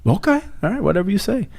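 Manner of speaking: high-pitched, doubtful giving in; dubious concession. Acted. Friend to priest after his odd explanation, high doubtful concession. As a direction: go up high as if humouring him.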